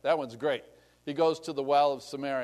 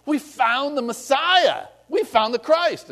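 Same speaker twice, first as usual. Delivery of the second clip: excited; 8 semitones higher, 5 dB louder, slower than usual. Retold or imitated. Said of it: "Philip comes to Nathanael and says".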